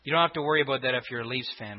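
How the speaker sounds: normal talking voice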